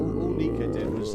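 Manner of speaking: low, boomy close-mic voice. Real, not acted. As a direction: speak right into the mic. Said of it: "move the microphone closer to his mouth".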